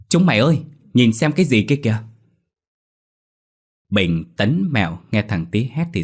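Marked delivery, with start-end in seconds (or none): 0.1-2.0 put-on loud boyish shout to his friends. A narrator's reading. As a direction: yell out to the others, pointing. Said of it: "thằng Tý mới hét lớn".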